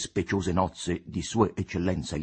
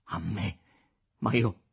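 stammering disbelief; 4 semitones higher than usual. A narrator's reading. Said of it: "balbettò".

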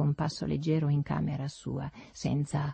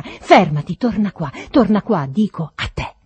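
loud, furious repeated commands; exaggerated roar; 11 dB louder than usual. A narrator's reading. Master calls back the servant who turns away, exaggerated roar. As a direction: roar it, overdone, demanding he come back. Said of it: "dovevo aver ruggito in modo così esagerato".

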